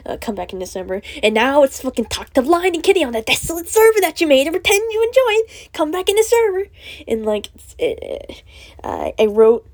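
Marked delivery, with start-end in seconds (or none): 1.2-6.6 loud, higher, exasperated tirade; heated rant. Real, not acted. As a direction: vent it loudly at friends. Ranting at friends who ignore server.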